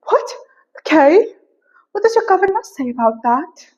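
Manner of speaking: high-pitched put-on accent; Lady Catherine. Lady Catherine quizzes Elizabeth on instruments, high affected accent. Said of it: "I don't know where I took this accent to".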